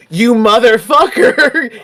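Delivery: acted furious yell edged with laughter; hammed-up scream. Player acts out Jack charging Bruiser, mock scream. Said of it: "you can hear him start screaming".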